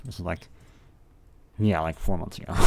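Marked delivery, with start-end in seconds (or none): none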